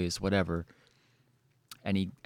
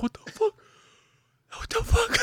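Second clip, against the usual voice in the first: high, squeaky mock shock; shocked disbelief. Sitting shook in the stall, high squeaky disbelief. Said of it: "I was just sitting there like".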